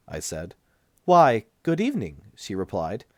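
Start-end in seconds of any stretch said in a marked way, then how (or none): 1.0-2.2 high, bright-voiced nurse, noticeably higher and louder; nurse's voice pitched high and loud. A narrator's reading. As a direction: play the prim hospital nurse, pitched well up.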